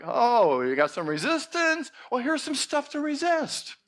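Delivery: high, teasing offer of trouble; sly cosmic joke voice; mocking universe. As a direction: tease the listener playfully. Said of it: "it's like".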